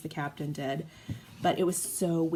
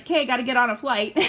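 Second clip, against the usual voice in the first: mock brave resolve; 5 semitones higher, 6 dB louder, faster than usual. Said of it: "I'm like".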